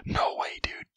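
ordinary talk

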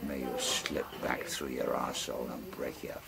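rolling bush-poem recital, relishing every line; ballad recitation. Acted. Storyteller reciting a bawdy ballad to friends, in a rolling recital voice.

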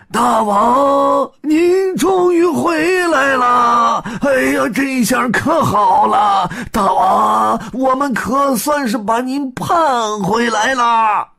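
high, loud, sing-song monkey elder; high monkey minister, overjoyed welcome. Acted. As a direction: cry out in delight to welcome the king home. Monkey minister greets king.

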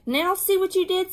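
put-on high-pitched childish blame; indignant, high accusation; accusing. A narrator's reading. Wayne blames Ramona after the spill.

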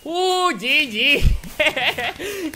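very slow, high drawn-out GG; stretched-out GG. Real, not acted. Gamer just won the match, drawn-out high GG. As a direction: savor the win.

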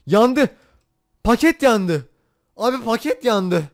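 loud, high cry of dismay; shocked outburst. Real, not acted. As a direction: yell out that the pack burned. Pack burns, gamer dismay. (yüksek sesle şaşkın feryat)

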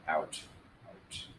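very soft, low, fading almost to nothing; quiet Macbeth quote, trailing into near silence; hushed resignation. Acted. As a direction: let each word die away like a snuffed candle. Quoting Macbeth's final resignation.

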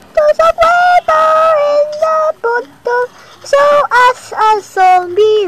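high, loud alarmed cries; panicked shouting. Real, not acted. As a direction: yell in sudden panic. Creeper spotted, panicking.

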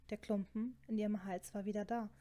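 plain reading voice

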